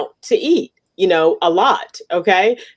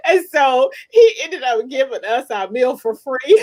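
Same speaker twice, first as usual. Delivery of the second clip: ordinary talk, nothing marked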